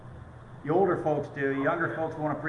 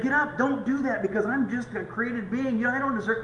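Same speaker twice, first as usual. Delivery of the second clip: higher, mildly hammed-up voice for a pretend Jesus; mock Jesus refusing worship; 8 semitones higher than usual. Preacher mocking a false Jesus, lightly voiced higher. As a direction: play Jesus waving off worship in mock humility. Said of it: "no, he didn't do that".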